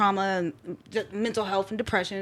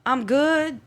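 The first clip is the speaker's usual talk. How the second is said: awkward brush-off; 3 semitones higher than usual. Retold or imitated. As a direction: wave the offer off, uneasy. Her own reply to mom.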